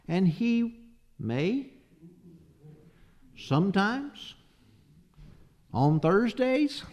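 "may", "sometimes", "on Thursdays" each rising high like questions; mock doubtful. Preacher misquoting the verse to test listeners, mock-doubtful questioning.

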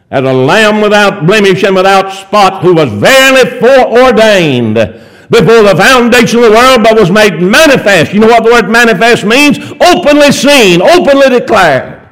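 high, driving sermon cadence; full-force preaching. Real, not acted. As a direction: proclaim it to the congregation in rolling phrases.

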